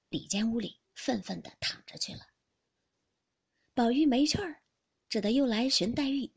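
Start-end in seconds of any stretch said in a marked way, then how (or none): none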